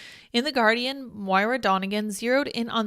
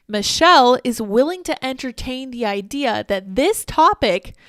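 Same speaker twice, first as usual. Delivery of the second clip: emphatic; 3 semitones higher, 9 dB louder than usual. Real, not acted. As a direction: drive the point home loudly. Praising Goldberg, emphatic.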